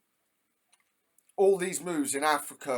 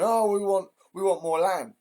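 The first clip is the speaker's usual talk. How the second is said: mocking greedy voice; 5 semitones higher, 3 dB louder than usual. Mocking world powers grabbing land.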